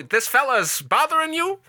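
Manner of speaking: put-on old-timey cop. Voicing the policeman.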